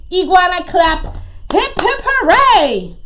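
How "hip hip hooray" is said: high, celebratory cheer. Acted. Iguana cheers and claps.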